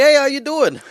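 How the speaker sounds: put-on loud, high New Jersey accent greeting. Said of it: "like, New Jersey".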